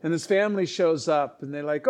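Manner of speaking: ordinary talk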